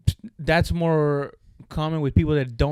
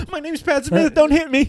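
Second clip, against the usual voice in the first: high-pitched hammed-up pleading; mock frightened plea; 12 semitones higher, 5 dB louder than usual. Teasing imagined plea from held friend.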